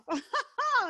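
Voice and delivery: a short, high burst of laughter; amused laugh. Real, not acted. Laughing to the host about keeping the weight off, amused laugh. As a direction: laugh it off at yourself.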